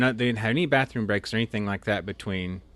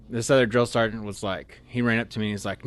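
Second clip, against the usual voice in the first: normal talking voice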